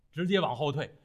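plain reading voice

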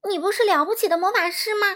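high, loud, fast question; indignant cry. A narrator's reading. Dorothy challenging the fake wizard, crying out indignantly. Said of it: "多罗西喊道".

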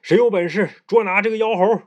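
a ruler's loud demand; 大声发问; commanding emperor. A narrator's reading. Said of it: "于是问道".

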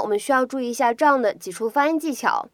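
normal talking voice

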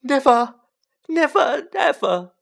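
loud, high tearful elf wail. A narrator's reading. Elf overcome by Harry's offer, wailing loudly. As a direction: wail each word at full pitch. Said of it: "he wailed".

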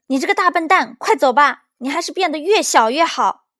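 high, very loud scolding; angry shout. A narrator's reading. Child mending his truck, yelling at the monster, angry shout. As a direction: yell to drive it away. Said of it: "一边冲着怪物叫道".

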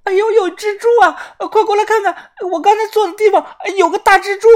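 high, loud, hammed-up fright; squealing alarm, calling others over; panicked cry. A narrator's reading. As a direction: shriek in falsetto like a terrified drama queen. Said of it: "脸色惨白的大声说".

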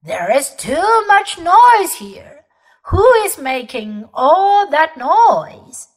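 loud, slow booming father; roaring. Acted. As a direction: roar it like an angry bear. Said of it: "Father Bear roared".

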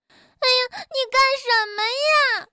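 音调高高地带哭腔惊叫; 被摔醒又惊又气地叫. Acted. 被推下床惊叫. (摔下床后高声带哭腔质问)

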